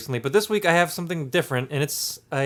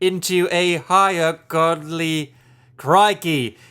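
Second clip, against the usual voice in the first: louder, slow hammed-up British-Australian; British accent turning Australian; 3 semitones higher, 5 dB louder, slower than usual. Host reads his beer can, British accent slipping Australian. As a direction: let mock British slide into Australian. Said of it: "it's gonna go Australian".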